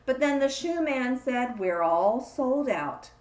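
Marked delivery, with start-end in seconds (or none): none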